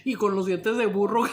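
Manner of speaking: loud laughing words; laughing out the punchline. Real, not acted.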